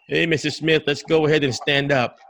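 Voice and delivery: brisk caregiver order in an everyday pitch; unwelcoming caregiver. Shown as a demonstration. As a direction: get the patient moving with no cheer. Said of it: "If I come in with like this".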